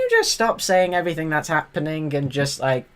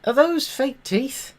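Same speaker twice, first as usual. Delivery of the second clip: incredulous; 10 semitones higher than usual. Real, not acted. Repeated disbelief at the teeth.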